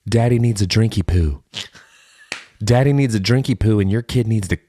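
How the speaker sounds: ordinary delivery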